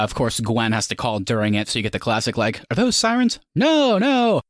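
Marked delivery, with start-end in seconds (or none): 2.7-4.4 high-pitched mock movie dialogue; high, playful re-enactment; mock film dialogue. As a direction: act out the call, pitched way up. Acting out the movie's classic call.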